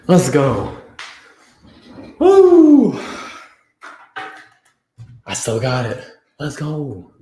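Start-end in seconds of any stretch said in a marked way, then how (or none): 2.2-3.0 high, loud celebratory whoop; victory whoop. Real, not acted. Celebrating twenty push-ups for the chat, loud high whoop. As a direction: let out a big triumphant woo.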